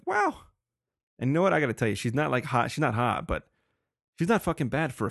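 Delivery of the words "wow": high squeaky wow; mock amazed wow. Real, not acted. Amazed reaction.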